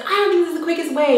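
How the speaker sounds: high, quick mock-panicky protest; mildly frantic objection; retold freak-out. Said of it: "I was just, like, freaking out".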